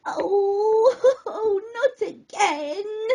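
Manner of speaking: grouchy crab. A narrator's reading. Crab snatched by a gull again, grouching. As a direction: grouch it out half laughing. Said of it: "Snippy grouched".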